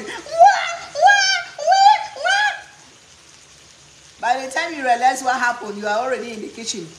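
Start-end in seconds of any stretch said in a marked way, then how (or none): 0.0-2.5 high, loud yelping wah wah wah; mock child wailing like a puppy; puppy-like screaming. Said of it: "screaming like a puppy".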